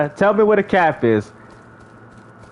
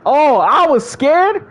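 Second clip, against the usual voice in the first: mocking; 5 semitones higher, slower than usual. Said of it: "I'm scared to debate".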